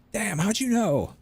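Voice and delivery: high, drawn-out incredulous cry; mock dismay. Friend caught faking his accent on the phone, mock dismay.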